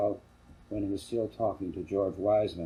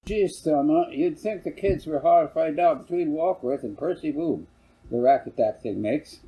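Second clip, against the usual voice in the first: a hint of a gruff dad, raised and loud; lightly voiced grumbling father; 7 semitones higher, 5 dB louder than usual. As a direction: complain about the racket, a touch louder. Father complains about the gong.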